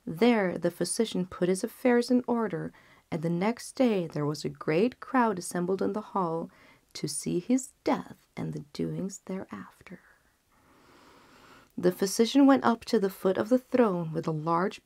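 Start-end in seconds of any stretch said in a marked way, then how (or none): none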